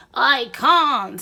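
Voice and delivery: loud, sharp snap; angry snap. Acted. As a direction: snap back, fed up. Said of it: "he snaps".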